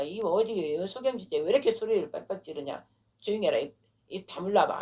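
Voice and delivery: usual speaking voice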